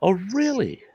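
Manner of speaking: high, startled oh really; mock surprise. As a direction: act out the shock of the reveal. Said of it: "and I'm going".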